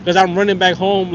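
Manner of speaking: ordinary talk, nothing marked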